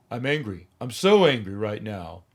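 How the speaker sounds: flat, emotionless mockery of a bad actor; mock deadpan actor. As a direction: say it with zero feeling, as if bored. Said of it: "You're not angry".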